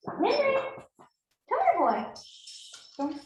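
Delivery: high sing-song call to a dog. Real, not acted. Calling the dog.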